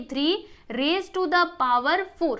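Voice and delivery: three raise to the power four read slowly and clearly; slow demo reading. Shown as a demonstration. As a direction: model the reading slowly for the class. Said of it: "हम इसको कैसे बोलेंगे".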